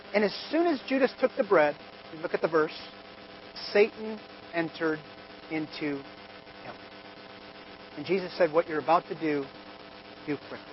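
low, hushed storytelling. A narrator's reading. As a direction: drop the voice to a dark hush. Dramatic hushed Judas retelling.

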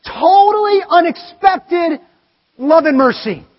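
loud, slow, high-pitched emphasis; preaching shout. Real, not acted. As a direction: shout it out slow to stress the mercy.

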